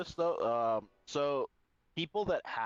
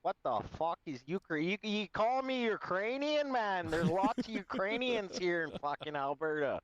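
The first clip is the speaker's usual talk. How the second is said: higher-pitched put-on Alberta accent; 6 semitones higher than usual. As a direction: ham up the Alberta hockey-bro talk. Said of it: "Alberta accent".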